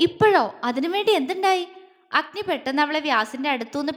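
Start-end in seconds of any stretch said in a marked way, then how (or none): 0.1-1.6 loud first word, then high questions; startled disbelief. A narrator's reading. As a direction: react sharply, taken aback. Startled reply to plea to leave.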